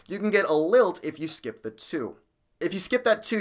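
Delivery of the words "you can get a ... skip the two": ordinary talk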